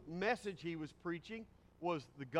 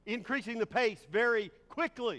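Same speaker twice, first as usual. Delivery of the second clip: higher and louder, rushed; sped up; 6 semitones higher, 6 dB louder than usual. Shown as a demonstration. Preacher demonstrating fast emphasis to listeners, rushed and louder. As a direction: hurry it out, pushing up and louder. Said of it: "You can emphasize by".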